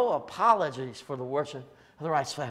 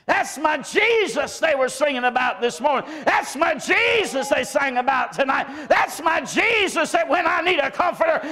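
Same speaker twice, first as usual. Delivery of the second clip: high, loud, fervent sermon shout; preaching at full force; 14 semitones higher, 7 dB louder than usual. Acted. Preacher rousing his congregation about Jesus, shouting high and loud. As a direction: build and ring it out to the church.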